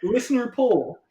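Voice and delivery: high, loud excited burst; excited whoa. Real, not acted. Host startling cohost with a listener poll, excited high burst. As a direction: jump in with a sudden eager whoa. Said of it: "I was like".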